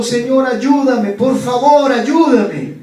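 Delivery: slow, high, loud pleading; a climber in the dark keeps crying to the Lord; crying out to God. Acted. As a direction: drag out each plea as if hanging on a rope. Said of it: "Y siguió clamando".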